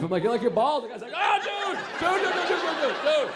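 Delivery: normal talking voice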